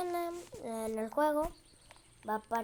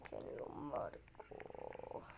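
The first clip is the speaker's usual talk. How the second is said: whispering; 11 semitones lower, 13 dB quieter, much slower than usual. Real, not acted. Mutters under breath.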